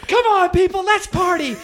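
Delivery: high, excited shout to the crowd; rallying party shout. Retold or imitated. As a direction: holler it up high like a desperate hype man. Performer rallying a stunned crowd.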